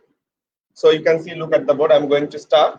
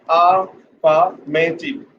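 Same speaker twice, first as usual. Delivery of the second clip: AFFIRMATIVE very slowly, in separated parts; syllable by syllable; much slower than usual. Shown as a demonstration. Teacher pointing out the pronunciation, syllable by syllable. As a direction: draw out each syllable of AFFIRMATIVE. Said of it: "This is the pronunciation".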